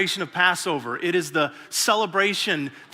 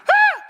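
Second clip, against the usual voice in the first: a sudden very high, very loud yelp; startled scream; 9 dB louder than usual. Retold or imitated. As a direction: shriek like a kid caught off guard. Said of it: "and he freaks out".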